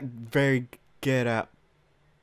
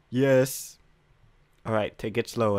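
ordinary talk

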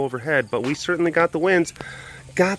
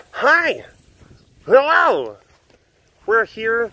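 high greeting to cat; 6 semitones higher, much slower than usual. Real, not acted.

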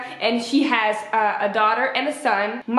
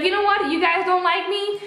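mock-indignant, higher-pitched quote; retold huffy outburst in a raised pitch; indignant mom; 8 semitones higher than usual. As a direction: act out a fed-up mother, pitched up. Acts out hurt mom walking out.

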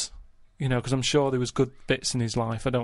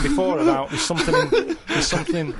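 amused, raised voice over laughter; talking through laughter; 5 dB louder than usual. Real, not acted. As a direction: keep explaining while laughter breaks out. Storyteller amid laughter at frog.

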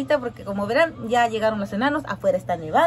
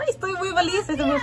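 laughing happily; 8 semitones higher than usual. Real, not acted. Gushing about first manicure.

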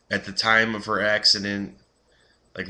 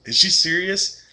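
put-on high, louder dopey kid voice, baffled; clueless goofy boyfriend; 10 semitones higher, 4 dB louder than usual. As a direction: play the dim boyfriend who doesn't get it. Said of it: "he's like".

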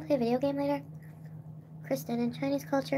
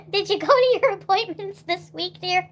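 put-on higher, louder, slower old lady; lightly voiced grandmother; 6 semitones higher, 5 dB louder, slower than usual. Grandmother checking on appointments, lightly voiced old lady. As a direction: ask like a fussing granny. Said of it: "grandmother asking".

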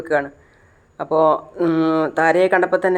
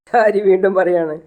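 ordinary delivery, nothing marked

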